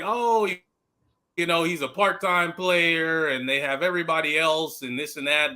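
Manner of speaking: ordinary talk, nothing marked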